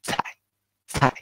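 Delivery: 菜 with a strong puff of air on the ts; 菜 said with a big breath of air on the c; strongly aspirated cai. Shown as a demonstration. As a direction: push air hard through 菜. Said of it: "a lot strong air pump out".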